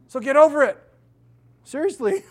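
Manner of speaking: high, loud urgent push; exhorting. Real, not acted. Pastor telling guilty parents to move on, loud. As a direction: shout it to shake them.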